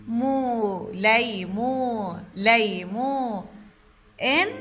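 drawn-out separate syllables of ليمون. Shown as a demonstration. Teacher splitting ليمون for children, syllable by syllable. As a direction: sound out لي، مو، إن with each part held apart. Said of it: "تقطيع كلمة ليمون".

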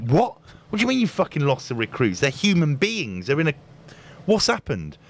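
incredulous outburst. Retold or imitated. Retold disbelief at lost recruits.

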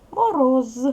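Мороз with a loud, long, buzzing з at the end; voiced final consonant. Shown as a demonstration. Teacher showing pupils the word's ending, voiced final з in Мороз. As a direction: say Мороз loudly, holding the final з fully voiced.

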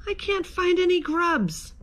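high-pitched plaintive cry. A narrator's reading. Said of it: "a voice cried".

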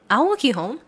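normal talking voice

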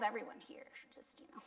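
a sly whispered reassurance; joking whisper. Real, not acted. Preacher joking with the congregation about annoying siblings, playful whisper. Joking aside after laugh.

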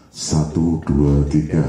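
pitch shift effect. Shown as a demonstration. Teacher counts with only the pitch shift effect on. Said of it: "Artinya suara efek".

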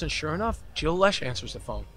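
usual speaking voice

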